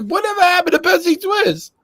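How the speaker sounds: high, wondering voice; high musing quote. Retold or imitated. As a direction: ask it like a puzzled fan. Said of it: "I was like".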